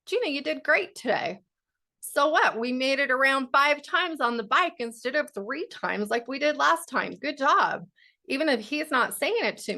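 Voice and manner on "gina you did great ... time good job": higher, upbeat mock pep talk; self-encouragement. Woman praising herself after physical therapy, encouraging. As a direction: cheer yourself on, pitched up. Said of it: "start giving that to myself".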